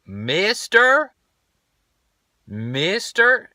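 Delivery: put-on high child's voice calling out; imitating daughter calling. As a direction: call for his attention like a little girl. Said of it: "She's like".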